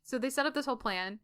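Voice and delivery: ordinary talk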